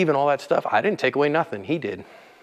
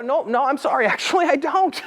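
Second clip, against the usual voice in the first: amused polite no, high and light; mock-sorry brush-off through a laugh; laughing refusal; 12 semitones higher than usual. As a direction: decline with a chuckle. Acted reply to demand.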